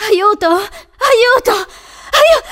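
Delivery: high, loud screams cut off short; piercing cries of panic; screaming for help. A narrator's reading. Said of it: "riprese a urlare".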